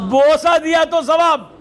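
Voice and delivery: slow, loud, high proclaimed climax; preaching shout. Acted. Preacher crying out to the congregation about the reward of kissing the Quran, in a slow shout. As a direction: drive the last line home with a cry.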